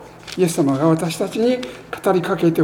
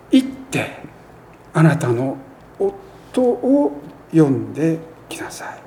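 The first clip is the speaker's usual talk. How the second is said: hushed command. Acted. Preacher voicing Jesus to the woman, hushed and fading. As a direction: let the command sink to a whisper. Jesus's words quoted again.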